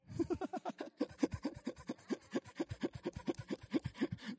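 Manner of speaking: breathy airy laugh; quiet unvoiced chuckle; God laughing. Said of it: "He who sits in the heavens laughs".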